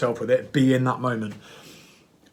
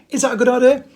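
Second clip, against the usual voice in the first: ordinary talk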